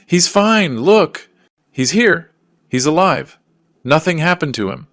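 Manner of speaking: loud, high-pitched screamed protest; exploding scream. A narrator's reading. Daughter protesting to police that father is alive, screaming. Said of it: "She screams".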